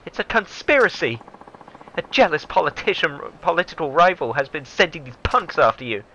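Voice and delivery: high, accusing character voice; indignant secretary. Acted. Secretary blames a jealous politician, high indignant voice.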